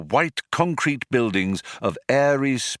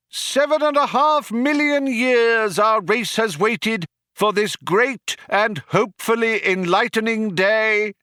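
cheerleader; 11 semitones higher, 3 dB louder than usual. A narrator's reading. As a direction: whip up the crowd over a tannoy. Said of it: "cried the cheerleader".

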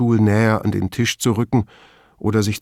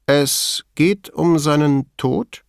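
slow, raised-pitch, measured question; slow and deliberate; 6 semitones higher, slower than usual. A narrator's reading. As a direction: weigh each word as you ask. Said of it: "antwortete langsam und bedächtig mit einer Gegenfrage". (langsam und bedächtig)

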